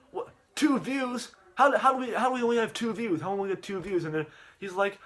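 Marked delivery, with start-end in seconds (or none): 0.1-4.0 high-pitched mock dismay; acted disbelief. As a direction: question it in raised, exaggerated disbelief. Acted disbelief at low views.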